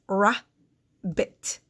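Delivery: "rabbit" as "ra ... bit", long gap between; rabbit, one syllable at a time; split into syllables. Shown as a demonstration. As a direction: break rabbit slowly in two. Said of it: "vowel sounds do you hear".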